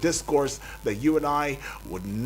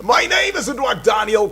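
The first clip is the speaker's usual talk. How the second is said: announcer intro; 8 semitones higher, 6 dB louder than usual. Acted. Host announces himself on air.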